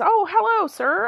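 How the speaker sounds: eager friendly greeting. Acted. Newcomer greeting the man at the city gate, eager and high. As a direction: greet the stranger with bright-eyed eagerness.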